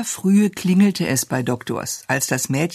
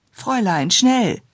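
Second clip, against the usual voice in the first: excited call; 6 semitones higher than usual. A narrator's reading. Child calling the governess once the bell rings, excited. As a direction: call out impatiently, pitch leaping up.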